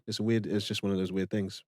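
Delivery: usual speaking voice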